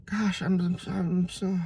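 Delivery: high, soft, faltering mock dejection; acted helpless frustration; bummed out. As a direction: trail off as a crushed kid. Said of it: "and he's bummed out".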